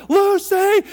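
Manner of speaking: high, loud call into the dark; shouting for the dog at one in the morning. Retold or imitated. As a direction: yell the dog's name from the doorway. Calling dog at night.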